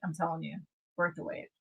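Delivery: normal talking voice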